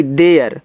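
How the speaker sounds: देयर said slowly and clearly; there pronounced. Shown as a demonstration. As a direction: model देयर for the class. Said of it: "लिखा हुआ है देयर". (देयर साफ़ उच्चारण)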